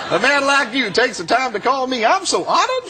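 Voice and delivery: very high, gushing character voice; high mock-flattered delivery; imitated honored reverend. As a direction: mimic a man sweetly thrilled by the call. Reverend gushes at the threatening caller.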